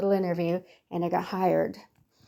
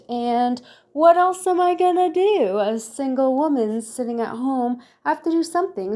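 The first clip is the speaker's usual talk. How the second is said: higher, louder, hammed-up lament; joking to viewers about staying home, mock-dramatic; mock self-pity; 6 semitones higher, 5 dB louder than usual. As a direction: play the lonely single woman for laughs. Mock self-pity as single woman.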